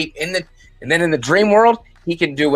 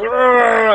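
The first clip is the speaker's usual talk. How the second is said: monster roar; 8 semitones higher, 5 dB louder than usual. Retold or imitated. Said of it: "and then he's like".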